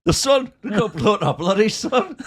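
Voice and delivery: high, excited mock-dim voice, laughing at the end; Nobby's excited voice. Podcaster doing Nobby misunderstanding Seb, high and excited. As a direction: blurt it out like a thrilled fool. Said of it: "And Nobby goes".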